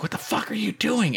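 surprised father snapping at son in driveway; dad's startled yell. Retold or imitated. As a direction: play the angry dad catching him. Dad yelling, voiced in story.

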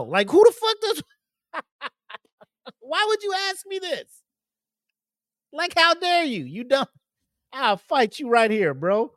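hammed-up fury at the reporter; outraged squeal. Homeowner ranting at the reporter, high mock outrage.

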